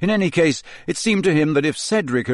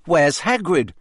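loud; 5 semitones higher, 3 dB louder than usual. A narrator's reading. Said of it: "Harry repeated loudly".

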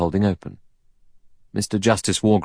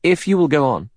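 raised pitch, mildly directing; slight command; 8 semitones higher than usual. A narrator's reading. Secretary directs the other guests, slight command. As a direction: take charge lightly. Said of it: "slight suggestion of command in it".

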